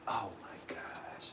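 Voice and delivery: breathless whispered aside. Real, not acted. Reacting to a name in her comments, whispered. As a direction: drop to a voiceless whisper.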